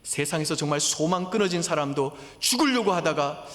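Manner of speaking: 높고 크게 외치듯 설교; 목소리를 높여 절박하게 호소하기; impassioned preaching. Acted. Preaching at full force. (열정적으로 외치는 설교)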